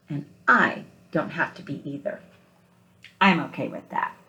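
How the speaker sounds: picture-book read-aloud, gentle and unhurried; story narration. Acted. Teacher reading picture book aloud.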